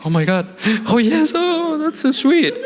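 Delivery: high, squealing mock bride voice; gushing bride. Bride hearing groom's vows, high gushing mock squeal. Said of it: "She's like".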